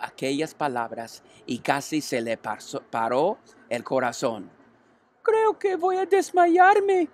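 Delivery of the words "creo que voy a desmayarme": very high, slow and weak piglet voice; a pig about to pass out, fading; faint little pig. A narrator's reading. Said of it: "le dijo a la oveja".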